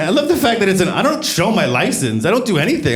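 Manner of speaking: ordinary talk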